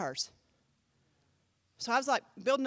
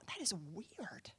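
slow, hushed whisper; barely audible, drawn-out whisper; whispered thought; 5 semitones lower, 17 dB quieter, slower than usual. Retold or imitated. Private thought while praying.

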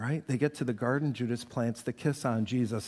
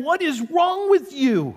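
exasperated Judas; 12 semitones higher, 6 dB louder than usual. Retold or imitated.

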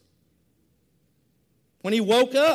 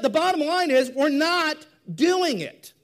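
ordinary talk

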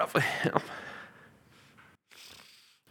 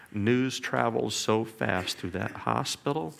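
ordinary talk

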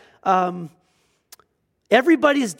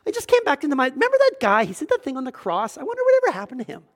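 much higher, fast, casual joking voice; mock Jesus; 5 semitones higher, faster than usual. Preacher mocks Jesus forgetting the thief, much higher, fast. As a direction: toss it off quickly, pitched way up. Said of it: "like Jesus is going".

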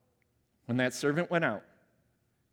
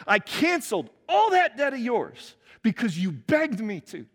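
loud, higher indignant reproach; angry master; 7 semitones higher, 5 dB louder than usual. Acted. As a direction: scold the ungrateful servant. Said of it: "he said".

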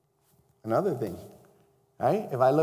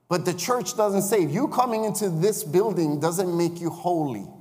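raised, insistent preacher voice; preaching at full force; 3 semitones higher, 4 dB louder than usual. Acted. Preacher urging the congregation to lead people to Christ. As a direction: drive the point home to the church.

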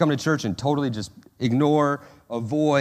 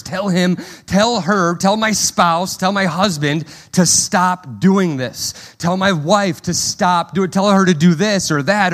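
higher, louder insistent demands to God; demanding prayer; 5 semitones higher, 7 dB louder than usual. Retold or imitated. Anxious believer ordering God about, loud and demanding. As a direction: list demands at God, pushing hard. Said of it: "we've prayed these prayers".

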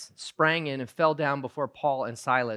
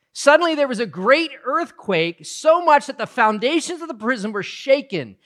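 rising, louder storytelling of the earthquake; dramatic scripture reading; 7 semitones higher, 6 dB louder than usual. Acted. Preacher reading scripture to the congregation with mounting intensity. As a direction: build the drama of the prison scene. Reads the jail story aloud.

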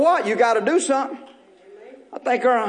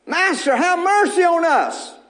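lepers crying out; 7 semitones higher than usual. Acted. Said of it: "He said".

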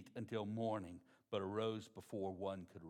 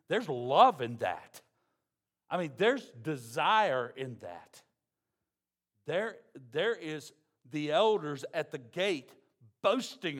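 much louder, slow, driving each phrase home; full-force preaching; 3 semitones higher, 10 dB louder, slower than usual. Acted. Preacher booming to the church about love and desire, slow and loud. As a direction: preach it out to the congregation.

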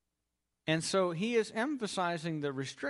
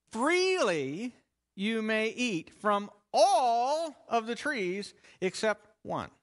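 Freely stressed with a slow rise, slower pace; emphatic quote; 4 semitones higher, 5 dB louder, slower than usual. Retold or imitated. Preacher quoting God's promise to the congregation, emphatic. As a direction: lean into the word Freely to show abundance.

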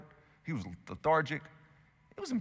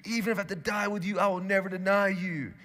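insisting; 7 semitones higher than usual. Retold or imitated. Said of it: "Peter insisted".

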